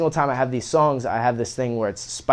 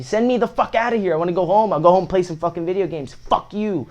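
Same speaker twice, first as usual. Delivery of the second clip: higher retold rant, half-joking; pitched-up imitation of a fed-up student ranting; mock rant; 6 semitones higher, 4 dB louder than usual. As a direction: replay a kid demanding to be sent home.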